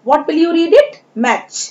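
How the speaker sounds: usual speaking voice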